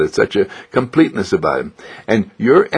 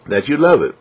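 ordinary talk, nothing marked

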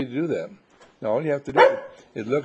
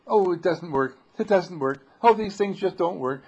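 higher, louder mock whining; whiny complaint; 6 semitones higher, 5 dB louder than usual. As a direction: imitate a frustrated quitter. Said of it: "if you're going to just say".

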